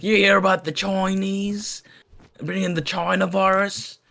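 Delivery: high, slow old-lady impression. Old lady gossiping to siblings on the bus, imitated old lady. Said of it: "And this old lady".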